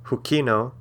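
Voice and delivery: normal talking voice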